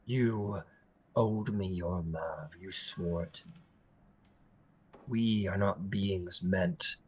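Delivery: low, slow, deliberate delivery; controlled hurt and cold anger; wounded, menacing fae prince. Acted. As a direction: speak low with long bitter pauses. Plays the wronged Prince.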